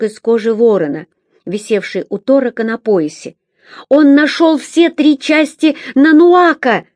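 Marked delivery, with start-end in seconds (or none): 3.9-6.8 high, loud insistence; pleading. A narrator's reading. Girl insisting to brother about the pieces. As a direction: make him believe it.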